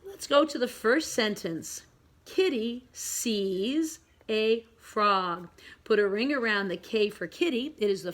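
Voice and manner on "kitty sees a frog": word-by-word slow reading; slow read-along. Shown as a demonstration. Teacher reads the story for pre-K pupils, slowly. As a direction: stretch each word for beginning readers.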